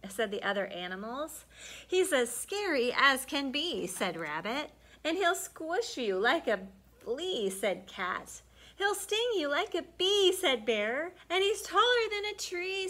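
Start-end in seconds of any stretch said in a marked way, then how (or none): 1.9-12.9 fearful warnings. Acted. Animals warn Mama Frog.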